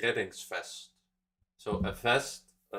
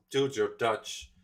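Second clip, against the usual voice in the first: ordinary delivery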